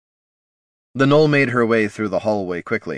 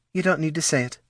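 gentle comfort; 8 semitones higher, faster than usual. A narrator's reading. Girl comforting.

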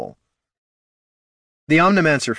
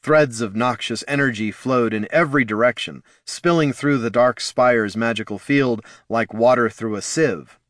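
ordinary delivery

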